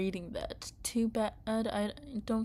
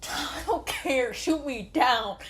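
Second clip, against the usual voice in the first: slow, loud, higher reckless dare; defiant gunner; 5 semitones higher, 6 dB louder than usual. Acted. Captured gunner daring the soldiers, slow loud defiance. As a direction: dare them to fire, laughing.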